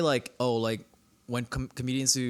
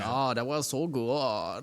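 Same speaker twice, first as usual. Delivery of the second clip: mock German accent; much slower than usual. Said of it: "Why did it have a Chinese accent?".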